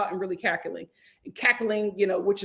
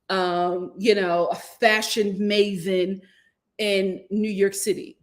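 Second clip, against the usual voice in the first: usual speaking voice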